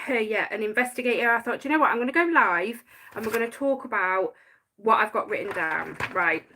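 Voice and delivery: ordinary talk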